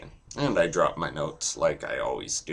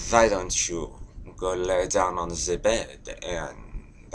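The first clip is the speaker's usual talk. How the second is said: German accent. Shown as a demonstration. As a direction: put on a German accent, drawing phrases out. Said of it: "German I have to set up for".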